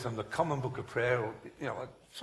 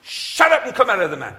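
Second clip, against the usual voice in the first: high, loud exorcism shout; shouted command; 7 semitones higher, 14 dB louder than usual. Retold or imitated. Minister retells commanding a demon, loud shout. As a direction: order the demon out at full voice.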